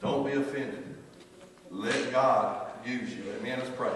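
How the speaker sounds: usual speaking voice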